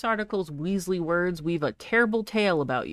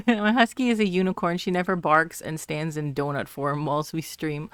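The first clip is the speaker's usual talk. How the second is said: ordinary delivery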